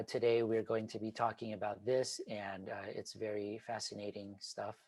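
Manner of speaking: flat, soft and trailing off; monotone. Shown as a demonstration. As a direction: drone it out like a bored teacher. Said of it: "So instead of speaking like".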